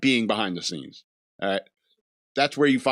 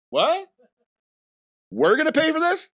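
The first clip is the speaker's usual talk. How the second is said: high, squeaky outraged disbelief; mock shock; 4 dB louder than usual. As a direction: Batman can't believe he's paying. Batman shocked about paying.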